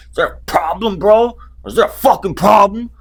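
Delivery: high, loud mock tough-guy challenge; tough guard challenge. Security guard walking up on him, loud high mock tough challenge. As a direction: square up like a bouncer picking a fight. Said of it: "some tough interjection".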